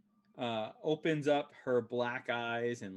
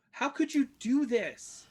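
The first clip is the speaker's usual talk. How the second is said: high, anguished acted outcry; crying mother; 11 semitones higher, 3 dB louder than usual. Mother hitting the one who brought her daughter, high anguished wail. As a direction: cry it out high, falling at the end. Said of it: "starts crying, bawling her eyes out".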